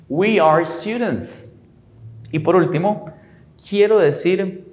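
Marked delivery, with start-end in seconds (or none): 0.1-1.6 We are students, a bit quicker second take; slow repeat. Shown as a demonstration. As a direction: repeat it slow but smoother. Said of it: "Se diría nosotros". (repetición lenta)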